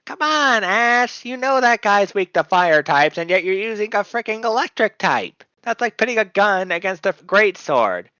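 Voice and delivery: high nasal cartoon girl voice; squeaky nasal little-girl voice; Lisa Simpson voice. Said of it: "high nasally voice".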